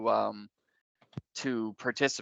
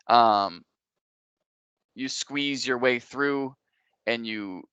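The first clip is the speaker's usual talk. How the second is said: ordinary talk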